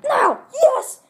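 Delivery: rising excited yes; excited cheer. Real, not acted. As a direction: shout it in triumph. Triumph at one minute.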